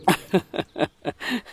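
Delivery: a short quiet chuckle; brief amused laugh before speaking; soft laugh. Acted. Nikhilesh laughs softly at the sister-in-law's question. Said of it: "Nikhilesh হেসে উঠে বলল".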